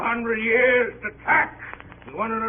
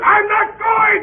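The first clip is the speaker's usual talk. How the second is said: very loud, much higher, slowed cry of refusal; panicked shout; 10 dB louder, slower than usual. Acted. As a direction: scream it as he's abandoned. Refuses to be left behind.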